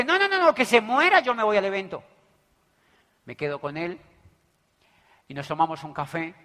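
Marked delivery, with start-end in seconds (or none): 0.0-2.0 high, loud flustered no-no-no; stubborn refusal. Retold or imitated. The stiff guy refusing the invite, loud and high. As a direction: brush him off, hurried and loud. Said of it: "El tieso que dice".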